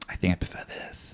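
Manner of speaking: whispered confession; a hushed aside, almost all breath. Real, not acted. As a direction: whisper it like a guilty admission. Admitting a preference, half-aside.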